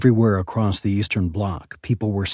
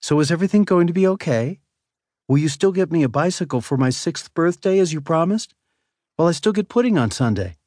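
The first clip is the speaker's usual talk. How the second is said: put-on high, curious little boy; a four-year-old asking his dad; small child voice; 8 semitones higher than usual.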